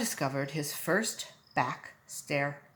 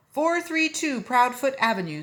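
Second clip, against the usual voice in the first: telling the address; 7 semitones higher, 7 dB louder than usual. Acted. Telling the driver.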